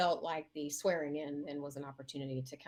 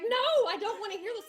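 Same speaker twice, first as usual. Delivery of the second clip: very high, loud protest; re-enacted screaming; 9 dB louder than usual. Candidate to friend outside the American Legion, mock screaming. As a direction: yell it over her, refusing the news. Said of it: "screaming at each other".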